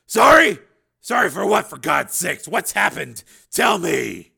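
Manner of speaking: loud, desperate demand; shout, frantic for an answer. A narrator's reading. Father shouts at son.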